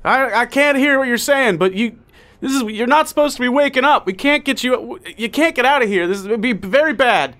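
high, loud panicky voice; flustered lab worker. Acted. Lab worker panicking outside the tube, shouting. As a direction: babble nervously, half shouting.

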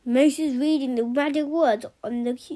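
ordinary delivery, nothing marked

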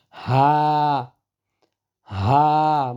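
হা with air; Arabic ha with breath. Shown as a demonstration. Teacher demonstrating ha with breath. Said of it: "বাতাস সহ".

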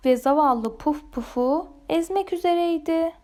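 usual speaking voice

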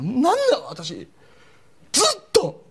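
high, wailing mock woman's voice; crying woman. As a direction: play the girlfriend bursting into tears. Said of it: "女の方がえらい泣き出した". (泣き叫ぶ女性の物真似)

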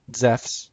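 Zephs, said zefs with an f; modern Greek pronunciation. Shown as a demonstration. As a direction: say Zephs as one syllable, zefs. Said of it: "for biblical Greek".

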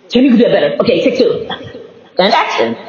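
normal talking voice

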